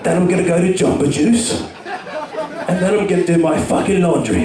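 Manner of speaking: lightly voiced tough guy; a hint of a gruff action hero; Jason Statham. Imagining the action star on his day off, a touch gruff. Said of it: "I can imagine Jason Statham on his day off".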